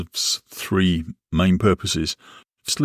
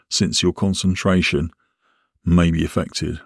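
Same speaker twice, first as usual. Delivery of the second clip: normal talking voice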